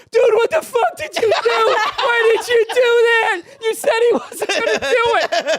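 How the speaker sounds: high, loud, laughing panic; half-crying freakout at his friend; panicked, near tears. Retold or imitated. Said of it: "almost in tears".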